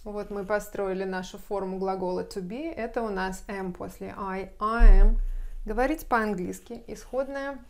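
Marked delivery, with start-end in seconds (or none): none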